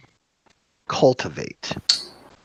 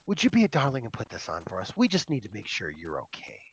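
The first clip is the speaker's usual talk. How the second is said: much softer, quick and sweet; soft coaxing elf lady; 8 semitones lower, 13 dB quieter, faster than usual. Acted. Elf woman softly coaxing the player to wear the bracelet. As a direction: coax her gently, quieter. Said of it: "She goes".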